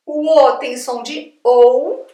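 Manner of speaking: "O" spoken slowly and clearly as o; a slow teacher's spelling of "O"; slow letter names. Shown as a demonstration. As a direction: lay out "O" slowly. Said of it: "elas têm esse som".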